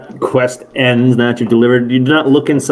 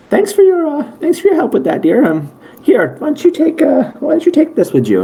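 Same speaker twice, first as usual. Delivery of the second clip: high falsetto nurse voice; Nurse Joy; 14 semitones higher than usual. Acted. Nurse thanks the delivery boy, high put-on voice. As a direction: thank them in a polite, high-pitched character voice. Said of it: "And she'll, and she'll go".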